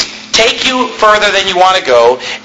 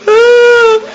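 high, loud mock wail of weeping; 4 dB louder than usual. Shown as a demonstration. Preacher demoing what crying means, a mock high wail. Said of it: "we think of somebody weeping".

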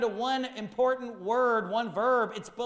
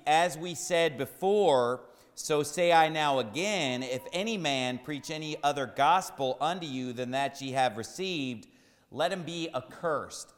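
scripture reading; 5 semitones lower than usual. Acted. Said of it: "where the Bible reads".

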